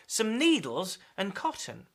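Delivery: normal talking voice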